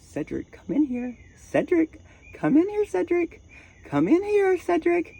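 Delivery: very high, eerie sing-song call; creepy calling voice. Retold or imitated. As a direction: lure him back in with a shrill, haunting call. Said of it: "Like, just like that".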